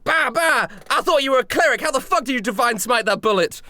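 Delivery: high-pitched indignant goon yell. Acted. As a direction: yell it out in outraged disbelief. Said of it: "they yell out".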